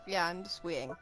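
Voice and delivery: ordinary talk, nothing marked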